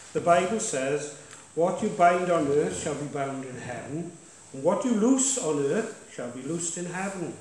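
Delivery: punchy pulpit declaration; preaching. Acted. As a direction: exhort the church, pushing key words. Pastor exhorts congregation from the front.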